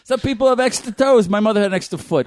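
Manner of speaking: put-on high, indignant Boston-accent outburst; defensive Boston local. Bostonian defending mother's extra foot, mock-indignant accent. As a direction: snap back as an offended Bostonian.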